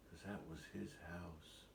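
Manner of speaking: usual speaking voice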